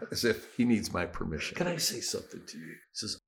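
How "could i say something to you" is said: hushed, brisk question; softer, quick, level request; quiet request. Retold or imitated. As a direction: imitate an official asking softly and fast. Official's request, repeated.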